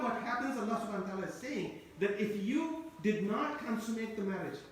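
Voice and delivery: ordinary delivery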